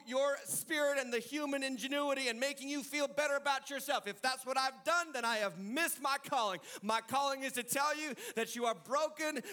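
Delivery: impassioned preacher; full-force preaching. Acted. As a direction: build it like an altar call. Preaching climax.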